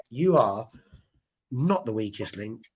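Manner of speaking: ordinary talk, nothing marked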